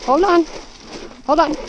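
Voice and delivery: loud high call to horse. Real, not acted. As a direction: call out firmly. Holding back pushy horse.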